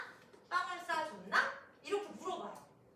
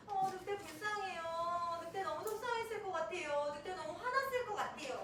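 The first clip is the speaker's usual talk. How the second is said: put-on pitying children; 4 semitones higher than usual. Children answering teacher about the wolf, mock childlike sympathy. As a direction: imitate kids feeling sorry, in a sing-out answer.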